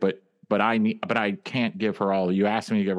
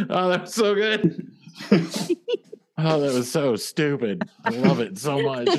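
laughing; 7 semitones higher than usual. Real, not acted. Laughing at the scene.

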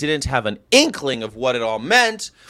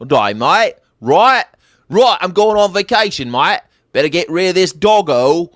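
hammed-up Aussie twang, pitched up; mock Australian accent; 5 semitones higher, 5 dB louder than usual. Lampooning Aussie holidaymakers in a mock Australian accent. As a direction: play a jokey Australian dumping his dog. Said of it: "Australians would be the worst".

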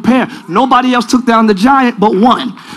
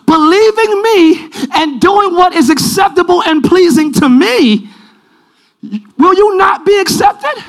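raised, ringing sermon delivery; dramatic scripture preaching; 7 semitones higher, slower than usual. Acted. Preacher voicing the Lord to Cain, raised dramatic preaching. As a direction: press God's question home, pitched up.

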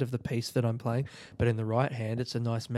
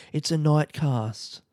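ordinary talk, nothing marked

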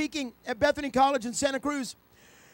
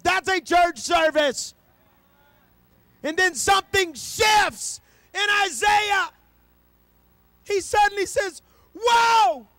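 high, loud, slow shouted preaching; a preacher hitting the climax; preaching at full force; 8 semitones higher, 6 dB louder, much slower than usual. Acted. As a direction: drive the point home to the crowd.

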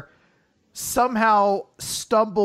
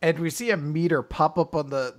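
usual speaking voice

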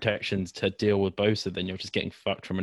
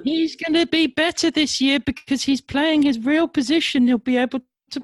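dumb voice; 7 dB louder than usual. Acted. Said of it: "saying it in a dumb voice".

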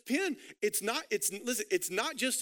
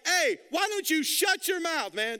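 loud, high rebuke; angry shout; 6 semitones higher, 4 dB louder than usual. Retold or imitated. Criminal shouting down the mocking criminal, angry shout. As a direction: snap at him to shut up. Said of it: "the other criminal is like".

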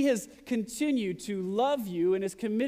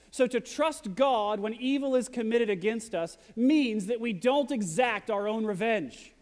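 ordinary talk

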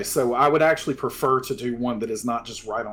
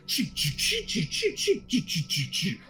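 transforming robot noise; 7 semitones higher, 4 dB quieter than usual. Acted. Transformation sound effect.

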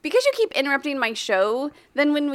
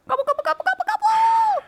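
high squeaky turkey gobbling; turkey gobble; 4 dB louder than usual. Acted. Turkey noise for Thanksgiving.

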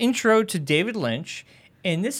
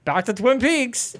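Lucy voice; 7 semitones higher than usual. Retold or imitated. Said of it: "And she's like".

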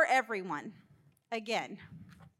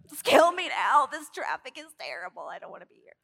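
mock despair; 7 dB louder than usual. Driver moaning to God in traffic, mock despair. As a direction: burst out, then trail off in self-pity.

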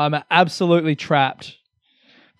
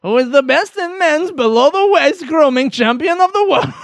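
put-on much higher, louder Kazakh-style Borat accent; loud, high mock Borat accent; 11 semitones higher, 5 dB louder than usual. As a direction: shout it like Borat. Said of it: "do the Borat voice".

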